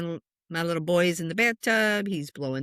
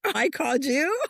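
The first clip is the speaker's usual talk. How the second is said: higher-pitched, incredulous echo; baffled question; 7 semitones higher than usual. Acted. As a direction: throw it back in disbelief. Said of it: "And I'm like".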